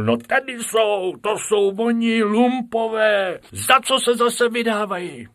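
high, loud indignant cry; loud high teacher outcry; exclaiming teacher. Acted. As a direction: shout out at the rabbit rascals. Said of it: "vykřiknul".

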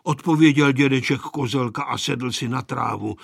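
plain reading voice

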